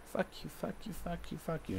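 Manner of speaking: high, hushed mock of mom swearing; imitating mom in a breathy high voice. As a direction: sneer it like his mother cursing. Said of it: "If you're like".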